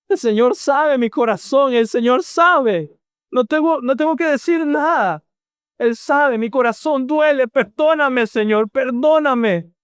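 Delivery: high, pleading voice near tears; a sinner sobbing to God; weeping prayer. Acted. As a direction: cry out for forgiveness. Acted weeping prayer of psalmist.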